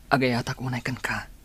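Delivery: normal talking voice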